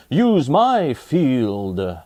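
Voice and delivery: very slow, different-voiced farmer; drawn-out farmer voice; farmer offering. Farmer offers his field to the villagers, slowly. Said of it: "Farmer Seed said".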